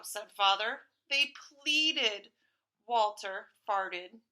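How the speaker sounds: ordinary delivery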